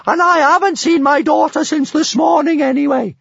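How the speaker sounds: very high, loud blustering bellow. A narrator's reading. Bertha blusters her way out, bellowing. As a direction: bluster at full volume in a high voice. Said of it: "she bellowed".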